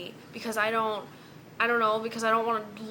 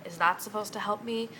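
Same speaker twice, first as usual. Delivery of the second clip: ordinary delivery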